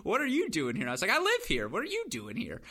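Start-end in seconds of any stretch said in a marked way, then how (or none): 0.0-0.8 retold disbelief, pitched higher; the cousin can't believe he's there; incredulous question. 1.1-2.6 retold retort, "I live here" pitched much higher; cheeky comeback. Narrator bounces question back.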